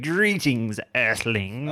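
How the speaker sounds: high, booming alien greeting; alien broadcast voice. Acted. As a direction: announce it like an alien invader.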